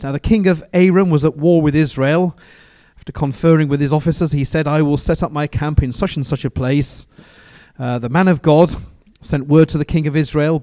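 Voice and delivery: scripture reading. Acted. Preacher reads scripture aloud to the church, measured and steady. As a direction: read the verses out to the congregation with weight.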